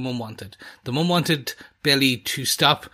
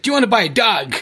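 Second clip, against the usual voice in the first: imitating Brad Pitt's Irish traveller accent, pitched up and louder; hammed-up Irish traveller accent, higher and louder; mock Irish traveller accent; 7 semitones higher, 5 dB louder, much faster than usual. Podcast host mocking Brad Pitt's Snatch Irish traveller accent, higher and louder. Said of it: "Irish traveller accent in Snatch".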